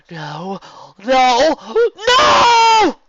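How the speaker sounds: building from cry to full scream; rising into a desperate scream. Acted. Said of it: "Someone was screaming".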